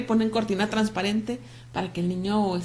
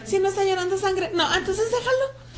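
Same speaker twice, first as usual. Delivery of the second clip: much higher, laughing mock of the old advice; mock stern elder; 10 semitones higher than usual. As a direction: ham up the harsh old advice while laughing. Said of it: "también". (imitación burlona y aguda, entre risas)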